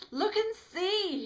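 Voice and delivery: very high, loud, slow panicked outburst; spluttered shout. A narrator's reading. Smitty spluttering at Bobby in horror. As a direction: splutter it out in shock. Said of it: "he splutters at me".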